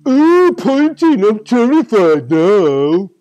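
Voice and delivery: loud, slow and sneering; mock him with fake fright; sarcastic mock fear. A narrator's reading. Tiger mocks the pointing man.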